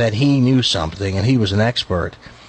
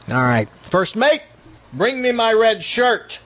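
captain's command; 11 semitones higher than usual. Retold or imitated. Captain's order in joke.

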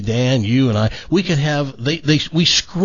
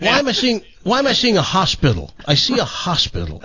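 fortune teller; 6 semitones higher than usual. Pretend. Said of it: "I am a great psychic".

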